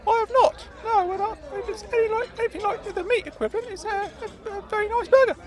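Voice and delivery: high squeaky faltering voice; high-pitched flustered character voice; flustered Claude. Acted. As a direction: bluster in a high piping voice. Claude admitting and insisting.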